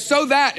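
"so that" hit high and loud; stress on so that. Shown as a demonstration. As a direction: punch "so that" hard. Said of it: "you circle those words".

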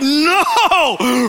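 loud, high, drawn-out cry; hammed-up anguished "no"; horrified wail. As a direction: wail it in mock despair. Acted horror at the answer.

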